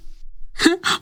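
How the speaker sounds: a quick airy giggle; breathy giggle. Acted. Flattered shop girl giggling at Othman's words. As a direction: laugh it off under your breath.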